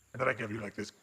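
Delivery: low gravelly dragon. Shown as a demonstration. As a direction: rumble like a dragon. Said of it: "kind of gravelly dragon voice".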